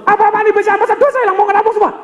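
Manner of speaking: hammed-up bank customer yelling; mock furious customer yelling at teller; angry customer shout. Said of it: "begitu dia ngikutin".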